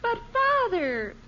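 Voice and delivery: pleading whine. Acted. Daughter begging father for a dress.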